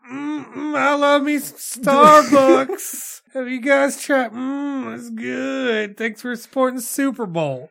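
high, slow breathy sponsor moan; hammed-up celebrity endorsement. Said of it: "She's like".

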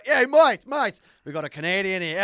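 loud Aussie bloke calling his mates. Acted. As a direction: shout your mates over.

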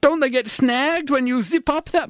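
high, slow fretful question; comic nervous victim; squeamish worry. Acted.

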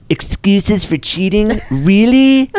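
high, slow mocking girlfriend voice; man doing a woman's voice. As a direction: stretch it out, rising in disbelief. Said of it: "I'm gonna need you to do it again".

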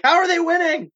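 high, loud cry of disbelief; incredulous shout. Acted. Henchmen watching the ragtag group fight, incredulous yell. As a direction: yell it like a stunned opponent. Acts out baffled enemies in pitch.

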